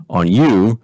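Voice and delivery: usual speaking voice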